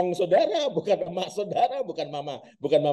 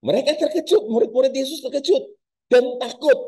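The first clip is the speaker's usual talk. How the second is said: startled; 10 semitones higher, 4 dB louder than usual. Acted. Preacher dramatizing frightened disciples.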